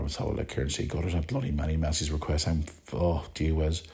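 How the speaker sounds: ordinary talk, nothing marked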